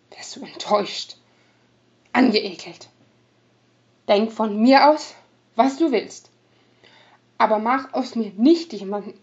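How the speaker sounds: loud, bitter rogue voice; clear, defiant rogue. Acted. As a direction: push back, suddenly sober. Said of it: "hielt er plötzlich viel klarer dagegen".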